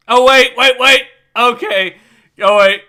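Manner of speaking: loud startled wait; sudden shout. Real, not acted. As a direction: call out to stop everyone. Sudden interruption of the game.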